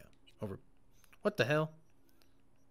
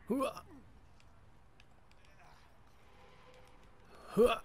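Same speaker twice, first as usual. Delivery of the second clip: effort cry; 10 semitones higher, 6 dB quieter than usual. Real, not acted. Jumping, climbing.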